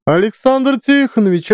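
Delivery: high shrieking call. A narrator's reading. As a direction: shriek his name across the basement. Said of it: "начинает верещать на весь подвал".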